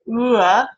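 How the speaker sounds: Ooh sliding into uh; ooh gliding to schwa. Shown as a demonstration. Lecturer showing the vowel glide, ooh to uh. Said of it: "move towards more of a schwa position".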